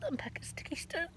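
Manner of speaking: soft unvoiced whisper. Real, not acted. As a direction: breathe the words quietly. Whispering near memorial stone.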